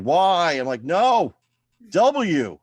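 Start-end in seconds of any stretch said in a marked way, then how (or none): 0.0-0.5 loud, higher mock yell; retold yell. Meter reader being kicked yells back, loud mock yell. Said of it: "He started yelling". 0.8-2.6 loud, higher shouted correction; mock angry shout. Comic yells correction at the meter reader, loud mock shout.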